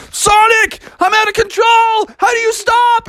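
high, panicky shout; acted high-pitched panic; panicked Froggy. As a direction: cry for help while careening out of control. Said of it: "And Froggy was like".

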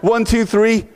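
ordinary talk, nothing marked

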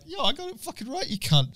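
high, mock yelling; half-joking yell. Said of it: "just yelling, half jokingly".

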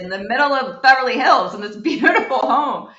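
breaks into a laugh partway through the line. Retold or imitated. Telling the lab where the sample came from, laughing. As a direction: let a laugh bubble up over the words. Retelling her answer to the lab.

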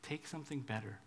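low, soft and tender; God meeting us in the gutter, gently; gentle offer. Retold or imitated. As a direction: offer it softly like a friend. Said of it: "he says".

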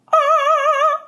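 short high shriek; mock scream. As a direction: let out a hammy scream. Mock scream after tagline.

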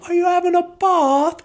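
same squeaky British lilt; mock British accent. Shown as a demonstration. Host shows how laugh sounds like bath in British. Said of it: "sounds like you're saying".

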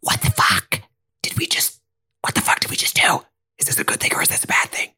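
frantic whispered questions, fast; panicked whisper. Acted. Harriet whispers to the twins in panic. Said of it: "and goes".

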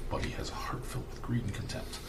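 hushed secretive whisper. Acted. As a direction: breathe it like a secret. GM confides a secret.

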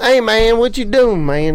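imitating the quarterback. Remembering the middle-school quarterback, a loud imitated call. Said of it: "He was our quarterback".

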